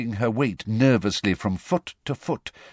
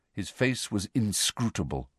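ordinary delivery, nothing marked

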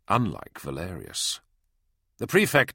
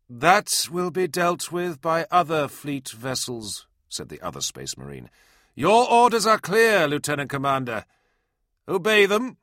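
stern commander; 8 semitones higher, 5 dB louder than usual. Acted. Liaison commands the lieutenant.

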